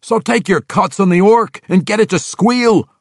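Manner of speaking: high, loud gruff insistence; arguing dwarf. Acted. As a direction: push back hard. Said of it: "Rollo argued".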